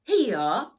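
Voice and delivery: big loud triumphant announcement. Acted. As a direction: present it with a flourish. Grandpa hands over the box.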